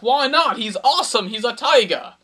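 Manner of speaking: loud, high-pitched pleading sales pitch; indignant protest. Acted. Matchmaker talking up a tiger to a reluctant cougar, indignant protest. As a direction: argue back in excited disbelief.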